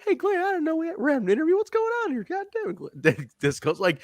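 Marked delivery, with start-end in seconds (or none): 0.0-2.9 very high, whiny put-on voice; David Young impression. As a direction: play the hapless guy caught unawares, in falsetto. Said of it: "He's like".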